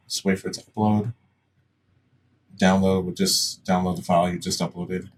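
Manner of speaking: normal talking voice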